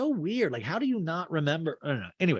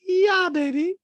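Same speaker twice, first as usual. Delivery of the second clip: very high, loud put-on voice; exaggerated high-pitched imitation; Dorit; 7 dB louder, slower than usual. Housewife answering Kyle, high loud mimicry. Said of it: "And Dorit's like".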